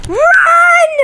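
sudden high yell to run; panicked shout. Real, not acted. Player fleeing the teacher, panicked shout. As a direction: scream it while fleeing.

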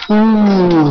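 high startled 'oh, oh'; frightened shriek. Real, not acted. Monster comes for him.

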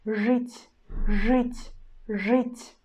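жить repeated with hard ж; Russian demo word. Shown as a demonstration. Teacher repeats жить for students, hard ж. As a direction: say жить clearly, hard ж and ы sound. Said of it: "and then we pronounce U".

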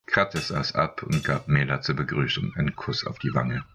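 plain reading voice

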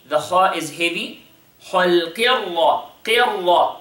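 usual speaking voice

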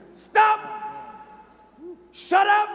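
"Stop, shut up" shouted high and loud; thundering rebuke. Retold or imitated. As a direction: roar it at the storm. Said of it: "he says".

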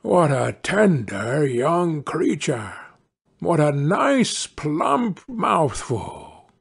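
slow, savouring wolf; greedy wolf thinking aloud, drawn out; scheming wolf. Acted. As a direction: relish every word hungrily. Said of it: "The wolf thought to himself".

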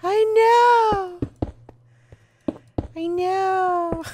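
high, crying mock mom; exaggerated mom voice. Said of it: "That's my mom voice".

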